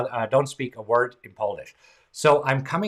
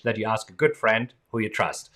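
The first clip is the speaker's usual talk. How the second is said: ordinary delivery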